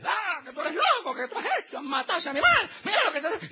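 high-pitched, loud hysterical scolding; screaming wife. Retold or imitated. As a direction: mimic an outraged wife screaming at her husband. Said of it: "comienza a gritarle".

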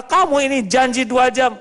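mock scolding, high and quick; hammed-up nagging mother. Preacher imitating a mother scolding her child. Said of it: "ada ibu marah-marah".

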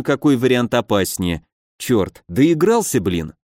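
usual speaking voice

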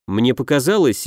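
plain reading voice

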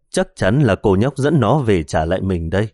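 plain reading voice

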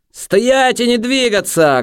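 high, drawn-out shout; slow, high-pitched yell; shouted order. A narrator's reading. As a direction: holler a command at their backs. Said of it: "крикнув наркоман їм у спини".

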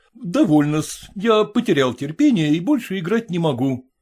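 ordinary delivery, nothing marked

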